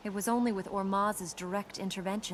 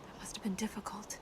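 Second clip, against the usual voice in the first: soft, unvoiced sympathy; whisper; 9 dB quieter than usual. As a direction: whisper it to comfort him. Consoling him about his family.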